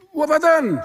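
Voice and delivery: high, loud desperate plea; pleading husband. Acted. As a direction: cry out in disbelief, high and loud.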